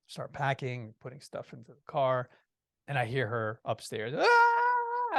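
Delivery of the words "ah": high, loud wail of pain; imitated scream. Wife upstairs hit by a contraction, imitated scream. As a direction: cry out in mock labor pain, high and loud. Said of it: "I start screaming".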